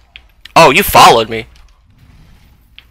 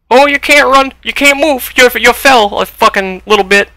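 exasperated whine; 9 semitones higher than usual. Real, not acted. Exasperated at game delay.

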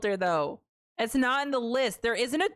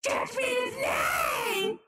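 high, slow screech through a voice filter; distorted high-pitched doomsaying; Toad as a god; 12 semitones higher, much slower than usual. Pretend. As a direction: proclaim it as a shrieking filtered deity. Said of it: "what if Toad was God?".

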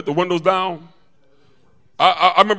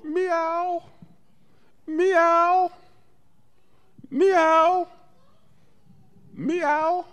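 high, drawn-out mock meows; imitated cat meow; much slower than usual. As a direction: bird mimicking a cat, meowing high. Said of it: "the bird would go".

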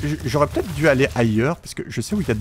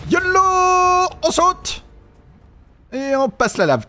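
excited shout; 13 semitones higher, much slower than usual. Real, not acted. Leaping over lava.

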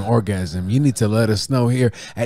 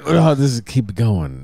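usual speaking voice

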